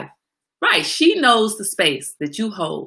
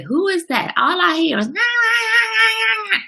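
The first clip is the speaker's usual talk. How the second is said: much higher, nasal-free mock whine "nah, nah, nah"; 3 dB louder than usual. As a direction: mimic the chefs mocking her high voice. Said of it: "the chefs at the restaurant ... would say".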